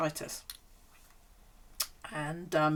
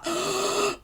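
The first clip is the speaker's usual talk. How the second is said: high breathy gasp; high mock gasp of pleasure; mock pleasured gasp; 14 semitones higher, 4 dB louder than usual. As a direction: imitate her bliss with a gasp. Imitating actress at foot massage.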